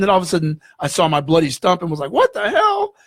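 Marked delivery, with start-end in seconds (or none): 2.1-2.9 high-pitched cry of disbelief; retold shock. Patient sees his bloody stump, retold shocked cry. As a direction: act out a startled, appalled outburst.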